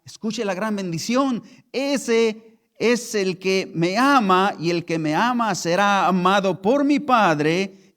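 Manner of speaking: loud, slow scripture reading; preaching the verse. Acted. Pastor proclaims Juan catorce to the congregation, loud. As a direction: proclaim it to the whole church.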